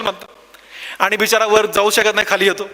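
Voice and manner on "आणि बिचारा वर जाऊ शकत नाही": ordinary talk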